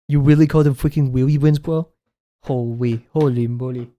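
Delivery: lightly put-on voice with R and L softened to W; playfully incredulous, with babyish consonants; a hint of babyish mock disbelief; mock baby talk. Mock disbelief at wheelie bins, put-on voice.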